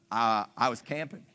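low, sheepish mumble; sheepish excuse. Retold or imitated. Worker gives lame excuse.

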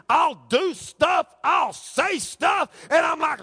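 high, drawn-out pulpit delivery; impassioned preaching. Acted. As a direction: preach it hard, stretching the key words. Preaches at full force.

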